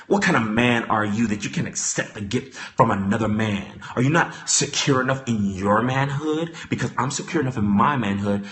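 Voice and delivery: low, quiet impression of Mister Willie; low mock Mister Willie. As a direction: mutter it low and hurt. Voicing Mister Willie again.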